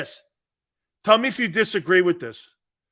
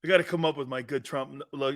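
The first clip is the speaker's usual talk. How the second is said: normal talking voice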